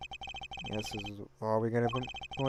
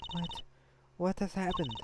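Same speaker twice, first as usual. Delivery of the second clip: ordinary talk, nothing marked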